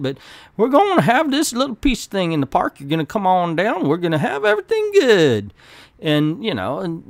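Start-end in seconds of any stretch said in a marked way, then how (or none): 0.6-5.5 put-on deep Mississippi accent. Hero pitching peace in the park, deep Mississippi accent. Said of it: "he would have this deep Mi-, Mississippi accent".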